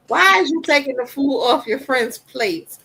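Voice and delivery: a mother scolds her son for jumping on the bed; scolding. Retold or imitated. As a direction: scold the kid with mock exasperation.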